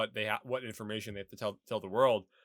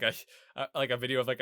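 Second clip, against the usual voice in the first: usual speaking voice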